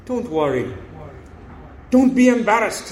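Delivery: usual speaking voice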